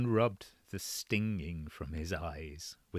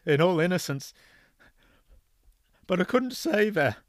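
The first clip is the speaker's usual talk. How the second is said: higher, louder voice breaking with sobs; sobbing; 10 semitones higher, 7 dB louder than usual. Acted. Father to son on phone, sobbing apology. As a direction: break down while confessing. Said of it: "started breaking up into sobs".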